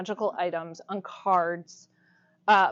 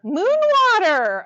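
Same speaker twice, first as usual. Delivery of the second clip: excited echo; 10 semitones higher, 10 dB louder than usual. Real, not acted. Teacher delighted by an audience member's suggestion, excited. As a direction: seize on the idea loudly.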